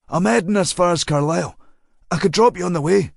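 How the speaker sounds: a hint of a chatty man leaning out, quickening at the end; casual, pushy offer, slightly rushed; lightly voiced friendly driver. A narrator's reading. Driver pressing the girl to take a lift, lightly voiced.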